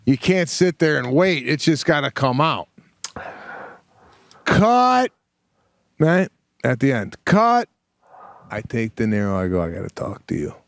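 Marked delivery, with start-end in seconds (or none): 4.5-5.1 high, held call of CUT; mock director's shout. Imitates director calling cut. 7.2-7.7 high, held call of CUT; mock director's shout. Imitates director calling cut. 9.6-10.5 low, soft confiding voice; lowered voice for a private word; quiet aside. Retold or imitated. Takes De Niro aside privately.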